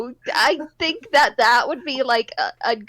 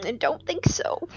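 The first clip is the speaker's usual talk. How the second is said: breathy whispered aside; 6 dB quieter than usual. Real, not acted. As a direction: whisper it under your breath. Quick aside to the player.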